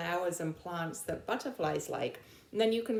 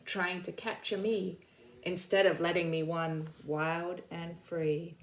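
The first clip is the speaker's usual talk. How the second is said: bold, louder storybook poem reading; reading a poem aloud; 4 dB louder than usual. Acted. Teacher reading the snow leopard's poem to her class, bold storybook voice. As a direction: announce each word like a proud cat.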